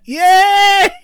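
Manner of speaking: very high, loud squealed cheer; obnoxious kid cheer. Acted. Imagined obnoxious kid cheering at film.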